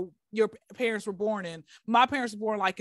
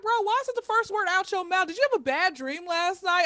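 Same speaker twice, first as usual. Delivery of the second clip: mock incredulous; 8 semitones higher than usual. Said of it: "people wake up like".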